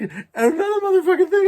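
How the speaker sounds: very high, loud mock-tearful cussing; crying outburst, retold. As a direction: burst out hysterically, imitating a crying rant. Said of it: "and I'm crying".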